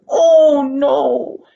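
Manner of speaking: loud, high, breaking "Oh, no"; a sudden loud sob of despair; anguished cry. Acted. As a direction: cry it out as hope sinks. Boy sees people leave house.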